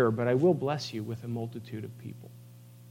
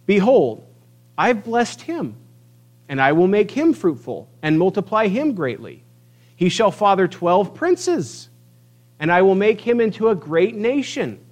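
louder, higher, preaching at full force; booming proclamation; 6 semitones higher, 7 dB louder than usual. Acted. Preacher voicing God's blessing on Ishmael, booming. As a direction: thunder out the divine promise.

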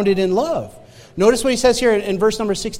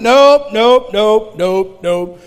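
loud burst of nopes fading down; mock panic, fading nopes; comic refusal; 7 dB louder, much slower than usual. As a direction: refuse comically, then trail off. Comic refusal after sad remark.

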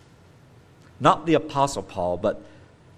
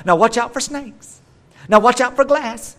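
high, loud mock-worried parent; fussing parent; 11 semitones higher, 7 dB louder than usual. Parent walking a child learning to walk, high fussy warnings. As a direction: call out warnings to a toddler. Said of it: "like walking a child when you're learning to walk".

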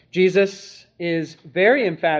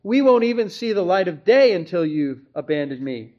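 ordinary talk, nothing marked

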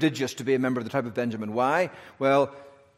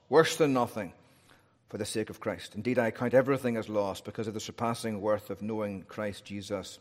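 scripture reading; 2 semitones lower than usual. Acted. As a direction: let the words weigh, read them reverently. Reads Paul's words from scripture.

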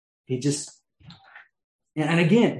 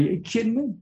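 scoffing; 7 semitones higher, 5 dB quieter than usual. Retold or imitated. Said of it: "basically he's saying".

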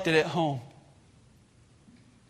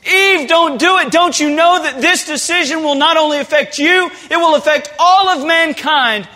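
high, loud, urgent plea; pleading shout; 8 semitones higher, 6 dB louder than usual. Acted. Preacher crying out to Eve from behind a rock, loud and pleading. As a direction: call out to stop her before it's too late. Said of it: "if we could just step up and say".

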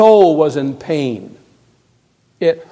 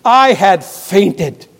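loud, raised scripture declaration; forceful preaching; 4 semitones higher, 8 dB louder than usual. Acted. Preacher proclaiming the psalm to the congregation, forceful. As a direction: proclaim it, slowing hard on the last phrase.

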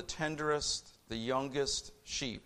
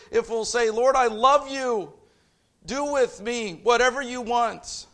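loud, high, ringing sermon climax; fervent loud preaching; preaching at full force; 8 semitones higher, 9 dB louder than usual. Acted. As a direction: preach it out to the back row.

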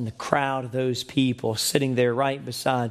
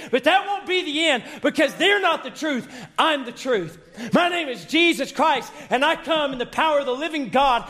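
preaching at full force; 13 semitones higher than usual. Acted. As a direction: thunder out Jesus' words to the high priest. Preacher voices Jesus, full force.

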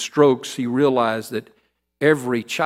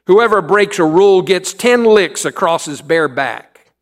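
tough bully; 6 semitones higher, 8 dB louder than usual. A narrator's reading. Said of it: "Big Tom spoke up".

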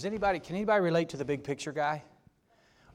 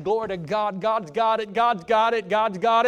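louder and higher, each "God's got it" building; joyful shout; 7 semitones higher, 9 dB louder than usual. Retold or imitated. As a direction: shout it out, running with joy. Running round the warehouse shouting praise.

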